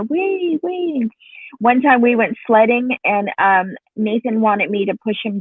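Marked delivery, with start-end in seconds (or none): 0.0-1.1 put-on high little-kid "wee, wee"; lightly voiced toddler asking; high toddler call. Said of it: "he was telling her".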